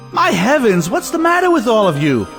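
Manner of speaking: high-pitched indignant protest; wounded, worked-up complaint in a high voice; exasperated outburst. Acted. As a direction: plead your good intentions, aggrieved, pitched right up. Exasperated brother protesting in argument.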